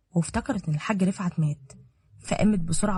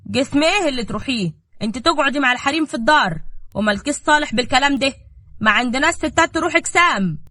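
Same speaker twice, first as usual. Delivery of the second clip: louder, higher angry uncle; stern refusal; 6 semitones higher, 6 dB louder than usual. Acted. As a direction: shut her down. Uncle forbids her angrily.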